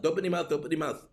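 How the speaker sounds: fast rattled-off repeated warning; very fast nagging repeat; rapid-fire nagging. Pretend. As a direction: gabble it like an exasperated parent. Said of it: "and we have to say".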